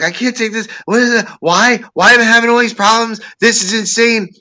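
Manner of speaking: high, loud frantic ranting; frantic panic. Retold or imitated. In the back seat, ranting frantically in panic. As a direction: rant in full panic. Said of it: "I'm like".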